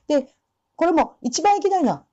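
ordinary delivery

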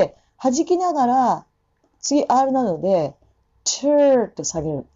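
normal talking voice

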